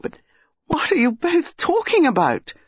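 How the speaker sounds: high-pitched, exasperated cry; baffled cry. A narrator's reading. Dinah, shut out of the conversation, baffled cry. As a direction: cry out, demanding to be told. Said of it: "Dinah cried".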